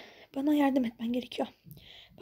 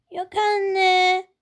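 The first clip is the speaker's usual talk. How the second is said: put-on high, slow, louder child voice; a girl feigning innocence to mother; sweet little girl; 5 semitones higher, 7 dB louder than usual. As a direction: play the innocent daughter.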